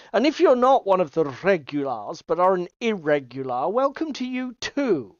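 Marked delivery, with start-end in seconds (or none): none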